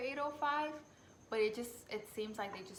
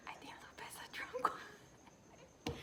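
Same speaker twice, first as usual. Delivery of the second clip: whisper; 6 dB quieter than usual. Real, not acted.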